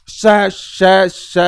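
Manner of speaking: each श sounded separately as a friction sound; demonstrated sibilants. Shown as a demonstration. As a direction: demonstrate श three times, one by one, for students. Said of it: "बोलकर भी आप देखेंगे".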